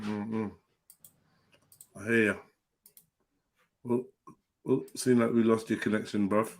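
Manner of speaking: normal talking voice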